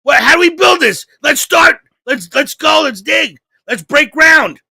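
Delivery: put-on rally like boys ready to dig; eager kids. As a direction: grandsons eager to start building. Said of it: "we're like".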